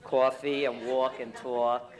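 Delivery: loud, broad Brooklyn vowels; Brooklyn accent. Shown as a demonstration. Comic showing a local audience their speech, heavy Brooklyn accent. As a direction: punch out the thick Brooklyn sounds. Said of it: "that's how you speak".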